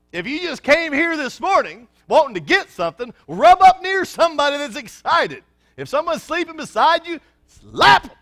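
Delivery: high, loud over-caffeinated preaching; wired excitement. Real, not acted. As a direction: bounce off the walls like too much coffee. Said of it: "I've had a lot of coffee this morning".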